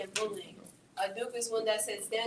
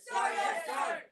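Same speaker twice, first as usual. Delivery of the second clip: loud gung-ho soldier; 7 semitones higher, 4 dB louder, much slower than usual. Acted. Military call and response.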